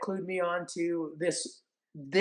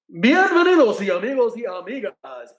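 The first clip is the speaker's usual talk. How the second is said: louder, higher bilingual ring intro; Spanish announcer call; 6 semitones higher, 10 dB louder than usual. Retold or imitated. Referee grabbing the mic to welcome the crowd in booming Spanish. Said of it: "whatever he said in Spanish".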